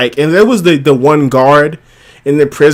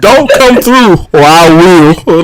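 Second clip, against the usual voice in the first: higher and louder retry of the threat; repeating the threat, higher and louder; high, louder mock threat; 2 semitones higher, 4 dB louder than usual. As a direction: punch it out like a tough guard. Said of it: "Say so".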